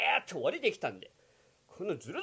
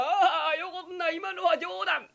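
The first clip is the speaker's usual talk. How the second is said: frightened pleading; 12 semitones higher, 3 dB louder than usual. Acted. Kokichi pleads.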